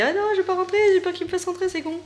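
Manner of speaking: high, cute pet voice; voix du chat. Pretend. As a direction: speak for the cat in a squeaky voice. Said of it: "elle a dit". (voix aiguë de chat qui parle)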